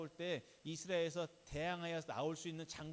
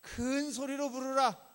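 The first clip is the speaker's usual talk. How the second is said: loud, high, drawn-out mocking cry; loud jeering shout from the scripture; mocking call; 9 semitones higher, 12 dB louder, slower than usual. A narrator's reading. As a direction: call out slowly at full voice, taunting. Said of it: "엘리야가 그들을 조롱하여 이르되".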